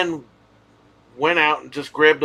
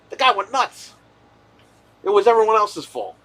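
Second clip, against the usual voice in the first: much higher, heated disbelief; incredulous outburst; 7 semitones higher, 4 dB louder than usual. Real, not acted. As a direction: blurt it out in shock at how crazy he was. Ranting about the deli owner.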